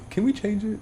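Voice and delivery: high, soft child imitation; small, timid kid asking a favor; little nephew. Nephew's request repeated.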